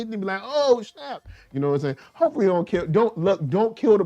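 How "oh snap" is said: high-pitched mock amazement; hammed-up astonished little exclamation; pretend surprise. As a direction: react as an impressed buddy, pitched way up. Imagined John's surprised reaction.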